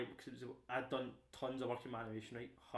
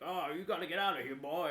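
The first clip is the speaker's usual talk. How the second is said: loud drawling American cowboy voice; American cowboy growling a warning; American accent; 5 semitones higher, 8 dB louder than usual. Retold or imitated. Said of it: "some American voice being like".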